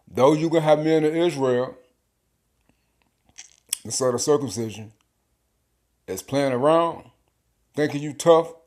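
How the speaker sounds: loud, punchy preacher call-out; fired-up preaching. Acted. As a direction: preach it hard at the proud men. Preaching at full force.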